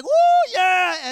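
very high, very loud joyful whoop; crowd cheering. Retold or imitated. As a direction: cheer like a whole congregation. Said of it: "everyone's like".

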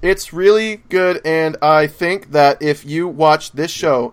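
slow, measured statement; slow deliberate review. Acted. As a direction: drag each word out to count them. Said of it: "I'm doing thirty-five words".

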